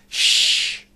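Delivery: sharp, very loud unvoiced hush; loud group shush. A narrator's reading. Everyone hushing the bears together, loud shush. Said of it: "said everyone".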